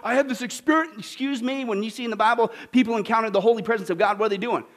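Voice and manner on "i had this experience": gushing fake-emotional churchgoer; mock testimony. Preacher parodying emotional testimony, hammed-up gush. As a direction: overdo the holy rapture. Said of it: "How many times you hear me".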